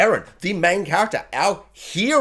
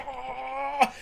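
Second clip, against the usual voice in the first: mock scream; 9 semitones higher, 8 dB quieter than usual. Reviewer imitating an anime character's face in the shot, mock scream. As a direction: imitate his scream, high and held. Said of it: "his face is just like".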